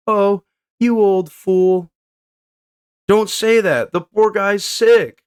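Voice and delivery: high, slow cartoon voice; high slow character voice. Voicing manga characters.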